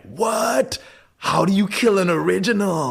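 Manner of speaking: high, incredulous mock gasp of disbelief; mock shock. Fans reacting to Elijah reviving, high mock disbelief. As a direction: act stunned that he's back. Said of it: "and it's like".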